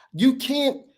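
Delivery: ordinary delivery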